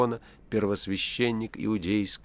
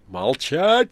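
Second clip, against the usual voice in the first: much louder, higher command; sharp shout; 6 semitones higher, 11 dB louder than usual. A narrator's reading. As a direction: cut him off with a yell. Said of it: "вскричал Пилат".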